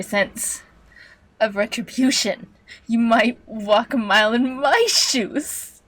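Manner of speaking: theatrical villain, swooping on key words; gloating master computer. Acted. Computer villain taunts deformed captive, gloating. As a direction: gloat and taunt with drawn-out pauses.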